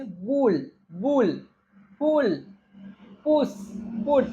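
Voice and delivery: बुल, पुल, पुश, पुट with a short oo. Shown as a demonstration. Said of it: "यू का उच्चारण उ बहुत कम". (बुल, पुल, पुश, पुट में छोटा उ)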